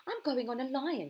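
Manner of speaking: high, loud eager call; excited child cry. A narrator's reading. Child at the fair calling out her ride, high excited cry. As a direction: shout it out like an eager kid.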